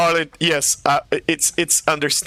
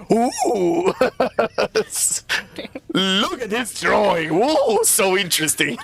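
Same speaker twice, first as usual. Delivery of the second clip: high, slow, awestruck "ooh"; wowed praising a drawing; 5 semitones higher, slower than usual. Retold or imitated. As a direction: marvel over the drawing, giggling. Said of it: "and he's like".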